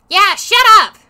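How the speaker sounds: loud high yell; shouting. Acted. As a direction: yell it along with her. Character joins the shouting.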